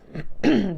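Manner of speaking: loud throat clear; quick cough to clear the voice. Real, not acted. Clears throat mid sentence.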